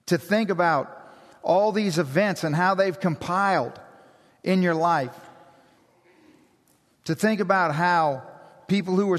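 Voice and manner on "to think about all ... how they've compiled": usual speaking voice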